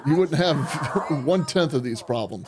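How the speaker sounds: words broken by real laughter; amused commentary dissolving into laughs; laughing while talking. Real, not acted. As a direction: laugh through the end of the sentence. Laughing at the suspect's struggle.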